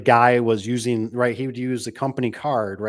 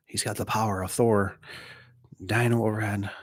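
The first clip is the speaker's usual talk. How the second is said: quiet whispered aside; 4 dB quieter than usual. Real, not acted. Host jokes about Mister Tony in a whispered aside. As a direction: whisper it as a side joke.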